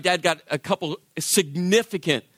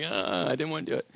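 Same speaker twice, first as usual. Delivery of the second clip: muttered confession; 6 semitones lower, 8 dB quieter than usual. Retold or imitated.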